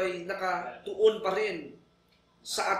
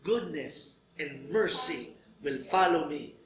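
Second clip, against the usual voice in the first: slow scripture recitation; 3 semitones lower, much slower than usual. Acted.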